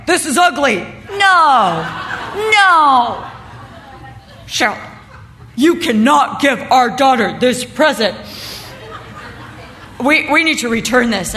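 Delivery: loud, mock-outraged husband; lightly acted husband refusing the present; appalled husband. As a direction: protest emphatically as the husband. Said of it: "Brian looks at me and goes".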